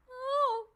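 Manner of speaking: high crying whimper; whimper demo. Shown as a demonstration. Coach demonstrating a whimper, high and crying. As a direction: whimper like you're about to cry. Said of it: "the next sound that we all really know is the whimper".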